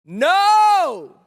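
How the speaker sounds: loud, high, long-held call back; drawn-out distant shout; answering yell. Acted. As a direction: holler the answer back across the dark. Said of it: "someone will answer back".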